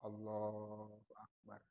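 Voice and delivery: barely voiced, slow Allahu Akbar; soft takbir demo. Shown as a demonstration. Teacher demonstrating the opening takbir very softly. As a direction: whisper it just above the breath. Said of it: "Yang takbiratul ihram ya".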